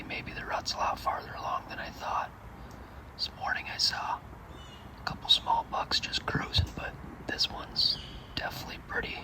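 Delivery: hushed whisper in the stand; hunting whisper. Real, not acted. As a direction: whisper so the deer don't hear.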